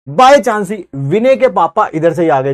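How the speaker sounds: usual speaking voice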